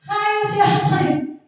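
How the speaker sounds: high, slow scolding "Hayo siapa ini?"; a teacher catching a noisy pupil, high and sharp. Shown as a demonstration. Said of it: "nada suara tinggi".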